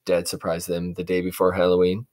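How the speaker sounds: ordinary delivery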